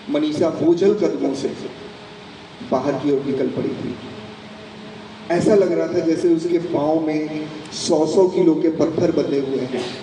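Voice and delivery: usual speaking voice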